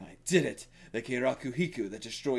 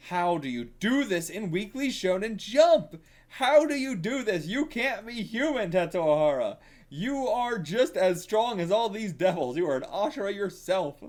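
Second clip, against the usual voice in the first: excited raving; 7 semitones higher, 4 dB louder than usual. Real, not acted. Raving about the artist.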